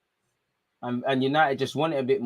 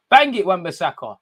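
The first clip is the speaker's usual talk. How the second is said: urging call; 5 semitones higher, 7 dB louder than usual. Real, not acted. Urging player on at corner.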